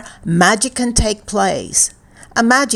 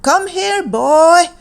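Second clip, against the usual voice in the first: high, rising call to a dog; 8 semitones higher, 3 dB louder, slower than usual. A narrator's reading. Rip calls his dog to head home, high calling voice. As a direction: call the dog over from a distance, pitched up. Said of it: "Rip called out to his dog".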